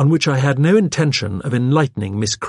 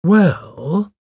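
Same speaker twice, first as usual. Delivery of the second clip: drawn-out, higher, rising hesitation; slow, hesitant; 9 semitones higher, 4 dB louder than usual. A narrator's reading. Vicar hedging to the secretary, slowly. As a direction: stall before answering. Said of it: "I said slowly".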